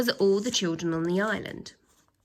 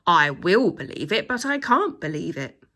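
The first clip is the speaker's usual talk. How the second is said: a loud, bewildered shout; 2 semitones lower, 7 dB louder than usual. A narrator's reading. Third teacher shouts in confused alarm, loud. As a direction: shout it out, flustered. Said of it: "shouted a third".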